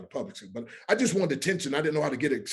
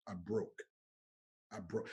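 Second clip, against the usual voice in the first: hushed, moved; 5 semitones lower, 14 dB quieter, slower than usual. Real, not acted. Man recalling his conversion, hushed and moved. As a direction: drop to a much softer, lower voice.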